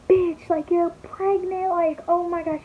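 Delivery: high, drawn-out dramatic reaction; dramatic best friend. Retold or imitated. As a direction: imitate a friend's over-the-top disbelief, pitched high. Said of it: "dramatic than I am".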